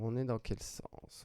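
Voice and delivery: ordinary talk, nothing marked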